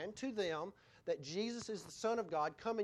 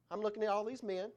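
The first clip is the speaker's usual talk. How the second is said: ordinary talk, nothing marked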